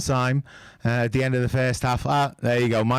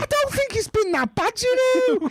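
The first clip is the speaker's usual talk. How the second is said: put-on very high, whiny Scouse accent; hammed-up whiny Scouse pundit. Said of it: "go back to my normal voice now".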